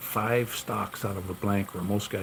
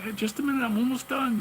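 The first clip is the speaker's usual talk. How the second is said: much higher, squeaky mock voice; high mock voice, brushing off coworkers to keep working; mock own reply. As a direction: pitch way up to mimic your own brush-off. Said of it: "And I said".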